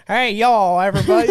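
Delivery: mocking Southern accent. Imitating a friend who mocks someone's accent, mock Southern twang. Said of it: "when he mocks her".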